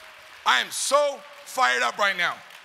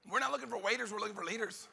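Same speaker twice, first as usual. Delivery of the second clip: hushed; 10 dB quieter than usual. Shown as a demonstration. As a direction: say it almost under your breath. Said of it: "hushed take before shouted repeat".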